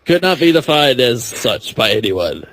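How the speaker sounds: ordinary talk, nothing marked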